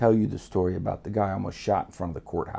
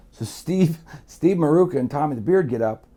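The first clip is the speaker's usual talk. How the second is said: ordinary delivery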